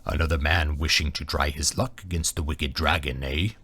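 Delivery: low, rumbling dragon voice; deep-voiced dragon sizing up a challenger; booming deep dragon. A narrator's reading. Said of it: "booming and deep".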